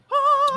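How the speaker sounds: very high, held heavenly note; imitated elated "ah". Hearing wife's praise at last, elated high "ah". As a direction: sing out a glorious revelation. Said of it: "I was like".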